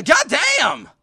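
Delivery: high, very loud yell; loud exclamation. Acted. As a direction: shout it with pitch shooting up. Yelled retake of line.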